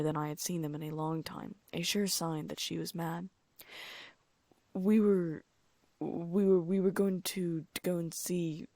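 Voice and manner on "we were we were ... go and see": nervous repeated stammer; stuttering. Acted. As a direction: trip over the words, caught out. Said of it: "Ron stuttered".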